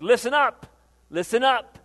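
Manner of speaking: loud, punched command; emphatic call to attention. Acted. Preacher paraphrasing Jesus to the crowd, emphatic call.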